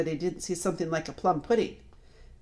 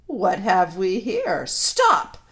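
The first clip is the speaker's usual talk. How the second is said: imperious witch; 3 semitones higher, 8 dB louder, much slower than usual. A narrator's reading. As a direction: draw out the question, then cry the order. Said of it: "the witch said".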